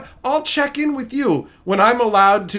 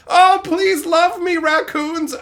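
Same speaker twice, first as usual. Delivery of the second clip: mock pleading; 10 semitones higher, 4 dB louder, slower than usual.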